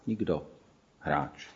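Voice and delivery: a lost man answering quietly in sorrow; smutným hlasem. A narrator's reading. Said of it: "Odvětil mi smutným hlasem". (smutně a tiše, hlas klesá)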